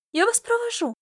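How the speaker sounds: put-on high childlike offer; very high-pitched, eager child; eagerly volunteering girl. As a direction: pipe up as a keen little girl. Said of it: "тут же вызвалась девчонка".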